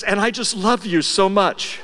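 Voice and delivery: mild mock tears, slow; mock tearful. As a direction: play his choked-up moment, half laughing. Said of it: "wells up with tears and says".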